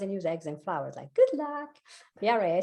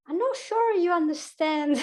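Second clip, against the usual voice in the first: slow, puzzled retort in a higher voice; mock puzzled reply; 8 semitones higher, 3 dB louder than usual. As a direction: slowly answer back with mock confusion. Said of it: "I'm like".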